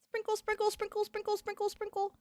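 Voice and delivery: high, soft sing-song chant; high soft singsong. Pretend. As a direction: sprinkle the food in a tiny high voice. Playfully feeding the pet goldfish.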